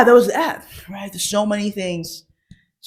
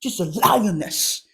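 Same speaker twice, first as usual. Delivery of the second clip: normal talking voice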